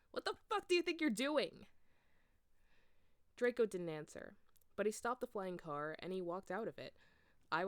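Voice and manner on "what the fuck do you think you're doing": high, loud furious demand; angry yell. A narrator's reading. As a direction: demand it at the top of your voice. Angry confrontation.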